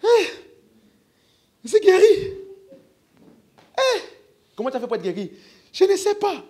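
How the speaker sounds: very high, loud, thrilled exclamations; excited healed man. Acted. Healed man telling a neighbor, high excited shout. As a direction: play the amazed healed man.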